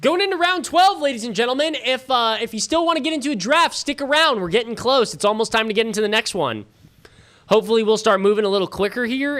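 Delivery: high, announcer-style hype call to the viewers; rally the stream like a ring announcer. Acted. Streamer hyping viewers for the next draft round, booming announcer voice.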